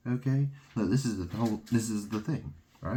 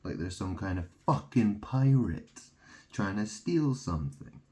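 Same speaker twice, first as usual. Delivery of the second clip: normal talking voice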